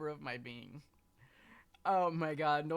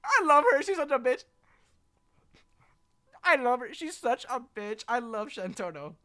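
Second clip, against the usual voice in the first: excited gushing; 8 semitones higher, 11 dB louder than usual. Real, not acted. Gushing over favourite character.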